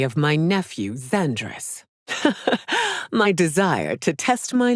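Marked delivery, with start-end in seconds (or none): 2.1-3.1 a high, rising laugh; amused laugh. Acted. Empress laughing at a challenger, high and rising. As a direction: laugh lightly up at them.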